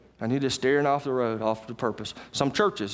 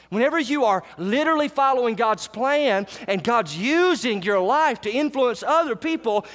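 full-force preaching; 9 semitones higher than usual. Acted. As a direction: lift the room, ring out each grace.

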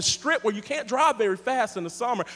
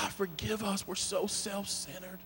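hushed confession; 13 dB quieter than usual. Real, not acted. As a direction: breathe the confession. Confessing prayer.

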